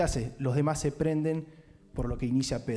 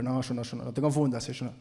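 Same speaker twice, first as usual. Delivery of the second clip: downcast denial; 3 semitones lower than usual. Acted. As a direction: mumble it, ashamed. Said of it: "Cabizbajo, Pedro".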